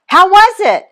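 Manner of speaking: high, loud call across the path; dad yelling up the path. Acted. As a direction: shout the question up to her. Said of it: "Dad yells".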